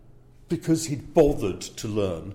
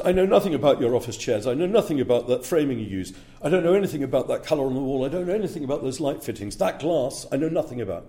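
imitating a fast, higher, louder breathless list; breathless rattle-off; 4 semitones higher, 3 dB louder, faster than usual. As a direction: rattle it off like a memory test. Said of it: "which was breathless".

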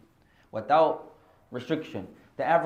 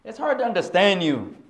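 questioning student; 6 semitones higher, 6 dB louder, slower than usual. Retold or imitated. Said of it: "So the question is".

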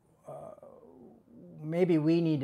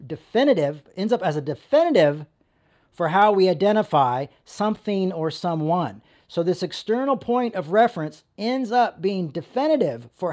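preaching at full force; 2 semitones higher, 8 dB louder than usual. Acted. Sermon building to full force.

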